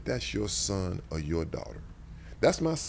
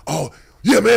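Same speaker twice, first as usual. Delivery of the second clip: mock shout; 14 semitones higher, 15 dB louder than usual. Teammates laughing at racist banner.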